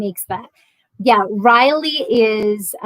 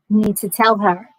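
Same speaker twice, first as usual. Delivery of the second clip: usual speaking voice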